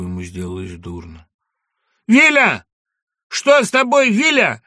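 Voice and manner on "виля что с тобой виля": high, loud cry of outrage; anguished shout. Acted. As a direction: scream at the guards blocking the cart. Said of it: "кричал Пушкин на жандармов".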